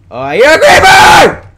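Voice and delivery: very high, very loud shriek; panicked scream. Real, not acted. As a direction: scream at a sudden threat. Creeper appears in the game.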